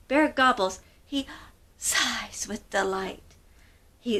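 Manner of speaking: ordinary delivery, nothing marked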